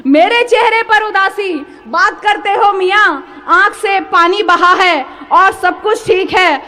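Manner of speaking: measured Urdu verse, each phrase projected; poetry recital. Acted. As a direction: declaim the couplets to an audience. Poet recites ghazal to audience.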